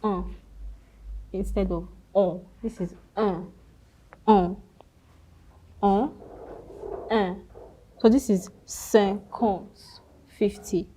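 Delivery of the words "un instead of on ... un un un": "un" repeated as the nasal French in vowel. Shown as a demonstration. Teacher repeating the nasal French in sound for students. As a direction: drill "un" through the nose. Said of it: "I N is pronounced".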